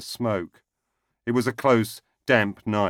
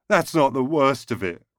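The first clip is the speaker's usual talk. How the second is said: blubbering; 6 semitones higher, 3 dB louder than usual. A narrator's reading. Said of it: "Jerry blubbed on".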